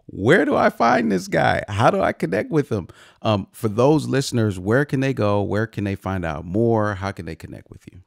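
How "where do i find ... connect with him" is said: high, loud eager voice; playful imitation of an eager fan; excited listener. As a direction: act out a thrilled listener wanting more. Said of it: "And now they're like".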